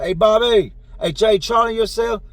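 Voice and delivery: loud retold call for attention. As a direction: mimic hollering for someone nearby. Calling out to another prisoner's cell.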